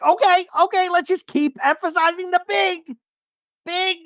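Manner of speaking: squeaky mock protest. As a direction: squeak out a nervous objection. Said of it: "And Shannon's like".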